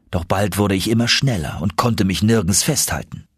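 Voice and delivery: ordinary delivery, nothing marked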